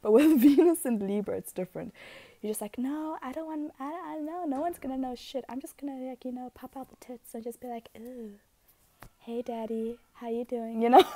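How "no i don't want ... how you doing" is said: playful coy flirt. Pretend.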